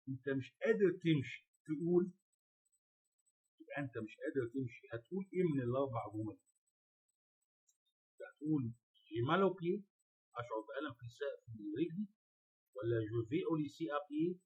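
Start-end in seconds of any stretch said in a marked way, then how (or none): none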